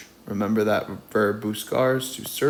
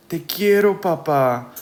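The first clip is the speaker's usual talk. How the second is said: loving papa; 6 semitones higher, 6 dB louder than usual. A narrator's reading. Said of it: "le dice su papá".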